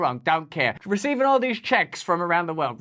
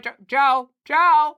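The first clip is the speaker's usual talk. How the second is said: louder, higher calling out; 6 semitones higher, 5 dB louder, slower than usual. Pretend. Host teasingly calling out to Joe, louder and higher. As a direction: call out to Joe.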